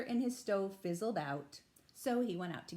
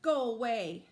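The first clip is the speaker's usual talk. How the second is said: stern; 2 semitones higher, 8 dB louder than usual. A narrator's reading. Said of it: "Bruce was very stern".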